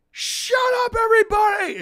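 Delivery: retold high-pitched crowd-silencing shout; re-enacted cracking yell; screaming. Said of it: "I'm screaming, and my voice is cracking".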